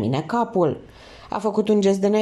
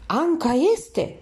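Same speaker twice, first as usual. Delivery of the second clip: stunned realization; 8 semitones higher, slower than usual. A narrator's reading. Shocked recognition of victim.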